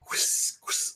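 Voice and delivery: voiceless whooshing spurts; two short hissed squirts; imitated blood squirting. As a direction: make soft spraying noises with the breath. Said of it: "like, squirting blood".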